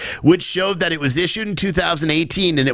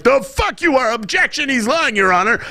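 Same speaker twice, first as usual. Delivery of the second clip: whiny tv court complaint; 8 semitones higher than usual. Pretend. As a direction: yell it like a TV lawyer objecting. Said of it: "I would have been like".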